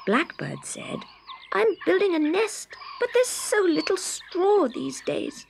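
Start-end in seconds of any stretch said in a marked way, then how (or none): 1.5-5.5 much higher little bird voice; small bird voice. Acted. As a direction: ask sweetly like a small bird. Blackbird asks Scarecrow.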